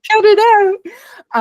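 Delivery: high, loud scolding mom; condescending mom voice. Retold or imitated. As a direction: scold like a let-down mother. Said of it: "mom condescending voice I've ever heard".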